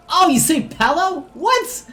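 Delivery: high, loud disbelief; surprised. Real, not acted. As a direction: squeal in disbelief. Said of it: "surprised reaction".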